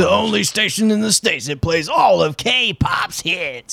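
hammed-up station ident; mock radio announcer. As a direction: sell it like a radio DJ.

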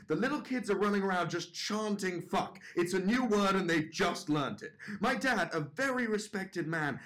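usual speaking voice

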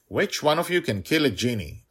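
put-on high, loud and commanding child voice; little dragon girl's demand. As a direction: call it out to the whole prison. Dragon's question performed loudly.

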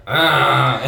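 loud high wordless yell; imitated yelp. Roommate woken up, yelling back in sounds. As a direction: blurt a startled wordless shout. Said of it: "yelled at me in sounds".

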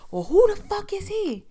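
loud, high indignant comeback; retold angry challenge. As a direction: mimic a jealous intruder yelling back.

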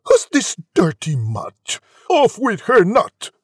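loud demand rising to an order; prince's cry. A narrator's reading. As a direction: cry out, building to a high command. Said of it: "The prince cried".

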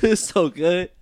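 choked up praise; tearful. Real, not acted. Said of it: "I'm tearing up".

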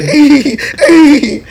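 very high, loud mimicked holler; excited calling out. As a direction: yelp for attention, hammed-up. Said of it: "He's excited, bro".

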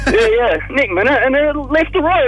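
high, lost and unsure mate; clueless mate voice. Retold or imitated. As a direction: play the mate who has no idea. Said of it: "my mate goes".

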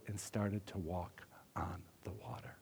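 whispered, breathless awe. Acted. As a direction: drop to a whisper on the water. Dramatic whisper in sermon.

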